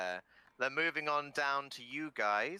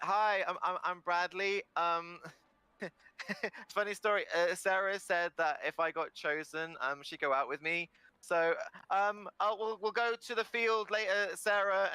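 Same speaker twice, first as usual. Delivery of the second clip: put-on eager nervous kid; 7 semitones higher than usual. Eager Bradley at the mic.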